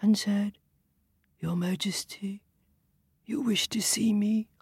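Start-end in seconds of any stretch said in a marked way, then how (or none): 1.4-4.5 tortoise whisper. Acted. Timid tortoise speaks.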